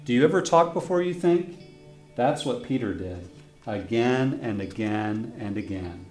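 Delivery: ordinary delivery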